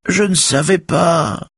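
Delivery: drawn-out falsetto mimicry of a woman's plea; mocking, piping falsetto; high whining squeal. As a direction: whine it slowly in a put-on high voice. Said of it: "piaule Raphaël en désignant la télé".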